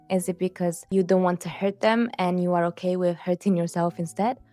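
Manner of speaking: ordinary talk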